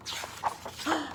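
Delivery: sharp breathy gasp of surprise. A narrator's reading. Reader gasping at Camille coming round the corner. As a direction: gasp as if spotting something amazing.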